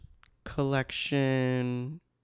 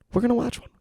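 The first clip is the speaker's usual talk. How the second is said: high bright repeat; 11 semitones higher, much faster than usual. Shown as a demonstration. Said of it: "they say things three times".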